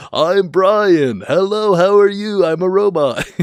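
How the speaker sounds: high, loud stiff robot greeting; hammed-up robotic hello. Mocking a fake spiritual persona, loud robot voice. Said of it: "change my whole demeanor and my voice".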